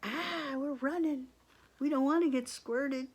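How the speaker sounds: high-pitched mock fleeing voice; playful panic. Lions running from squirting elephants, playful panic. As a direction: pretend to flee, voice up high.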